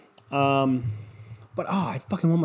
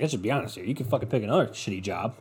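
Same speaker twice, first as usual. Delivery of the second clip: usual speaking voice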